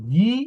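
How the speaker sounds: "গি" pushed out with force; forced onset. Shown as a demonstration. Teacher contrasting similar letters for students, forced "গি". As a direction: press hard into "গি", long vowel. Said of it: "force দিয়ে উচ্চারণ হয়".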